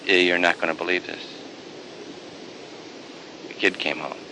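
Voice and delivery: low, offhand hired hand voice. Acted. Hired hand tells the older son, low and casual. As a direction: drop down low and gossip it.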